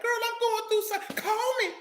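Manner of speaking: high mock girlfriend voice, lightly dramatic. Mocking how women call a friend. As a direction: play a woman calling her friend for support.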